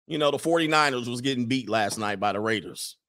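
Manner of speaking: usual speaking voice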